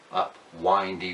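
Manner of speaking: ordinary delivery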